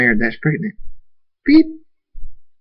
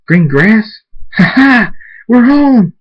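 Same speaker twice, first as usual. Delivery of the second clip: rising to a high, loud, happy cry; overjoyed homecoming yell; joyful shout; 12 semitones higher, 8 dB louder, slower than usual. Acted. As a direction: build from disbelief into a whoop of joy. Thunderstorm realizes they've arrived home.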